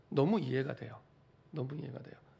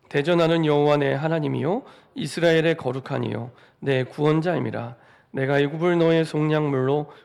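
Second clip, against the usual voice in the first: unison scripture reading; 7 dB louder than usual. Real, not acted. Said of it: "같이 한 목소리로 합독하겠습니다".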